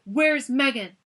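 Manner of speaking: loud furious demand; angry shout. A narrator's reading. Noah shouting at the guard about his sister, loud. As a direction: explode with anger at him. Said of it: "spun around and shouted".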